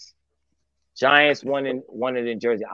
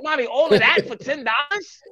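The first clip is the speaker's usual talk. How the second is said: mock amazement; 11 semitones higher than usual. Customer amazed at cheap food plate, mock high surprise. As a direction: gasp at the price like a bargain. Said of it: "I'm like".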